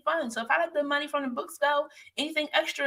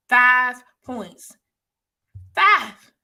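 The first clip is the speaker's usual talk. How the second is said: loud exasperated repeat; 13 dB louder, much slower than usual. Retold or imitated. Stressing how close she missed.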